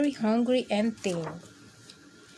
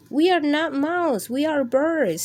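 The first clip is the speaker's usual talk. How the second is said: higher, louder little birds; chirpy birds voice; 6 semitones higher, 5 dB louder than usual. Acted. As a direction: squeak it out like a flock of birds. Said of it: "say the birds".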